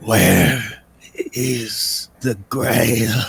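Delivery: very slow, drawn-out rasping words; eerie coffin creature. Acted. Coffin figure recognising the intruders, slow eerie rasp. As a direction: labour each word out like something long entombed.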